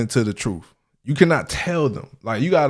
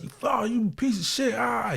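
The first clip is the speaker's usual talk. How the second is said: mock coach yelling; 11 semitones higher, 4 dB quieter than usual. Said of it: "they would be yelling at us".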